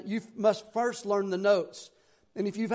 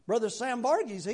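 normal talking voice

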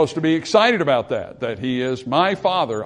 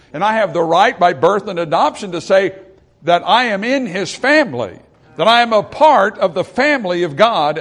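rising, louder fervent preaching; preaching at full force; 5 semitones higher, 6 dB louder than usual. Real, not acted. Preacher to his congregation, claiming God's family, full-force preaching. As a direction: drive it home to the congregation with fire.

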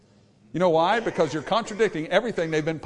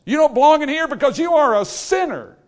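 loud verdict; 8 semitones higher, 7 dB louder than usual. Acted. God's verdict voiced loudly.

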